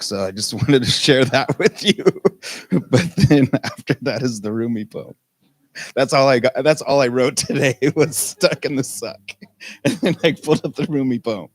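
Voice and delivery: talking through giggles; amused, laughter breaking into the words; real laughing speech. Real, not acted. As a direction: confess it while cracking up. Laughs while confessing tiny talk preparation.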